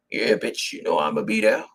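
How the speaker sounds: a hint of a low male voice; Big Dick Tyrone. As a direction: drop it low like the man. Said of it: "talked to Big Dick Tyrone".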